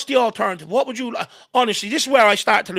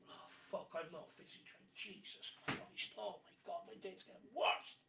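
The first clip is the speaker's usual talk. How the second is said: far from the mic; 11 semitones lower, 5 dB quieter than usual. Real, not acted. Fetching a drink, away from mic.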